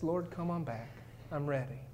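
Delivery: a quiet, worn-out surrender; hushed tired prayer; weary prayer. Retold or imitated. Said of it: "I've said that prayer".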